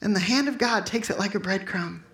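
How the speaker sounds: ordinary delivery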